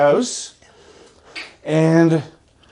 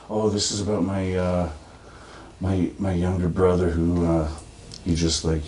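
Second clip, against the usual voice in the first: very low and very soft, mournful; mock sad poet; 12 semitones lower, 10 dB quieter than usual. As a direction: sad open-mic confession. Mocking sad poet at open mic.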